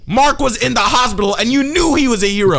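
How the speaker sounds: high, loud hammed-up accusation; mock outraged confrontation. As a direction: hurl the accusation in mock fury. Acting out an angry confrontation.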